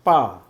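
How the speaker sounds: پا with lips pressed hard; firm پا. Shown as a demonstration. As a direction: squeeze the lips for پا. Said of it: "تھوڑا اس کو دبائیں، ہونٹوں کو".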